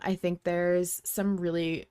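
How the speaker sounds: normal talking voice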